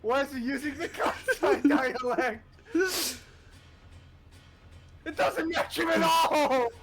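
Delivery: high, loud laughing protest; laughing shout. Real, not acted. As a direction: cry out through laughter.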